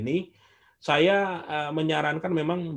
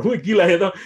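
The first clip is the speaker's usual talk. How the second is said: laughing, louder burst of disbelief; laughing amazement; 5 semitones higher, 8 dB louder, faster than usual. Real, not acted. Speaker telling audience his debt size, laughing.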